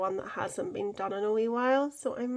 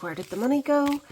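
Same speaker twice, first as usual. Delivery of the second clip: mock panic; 6 semitones higher, 5 dB louder, faster than usual. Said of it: "I had a panic attack there".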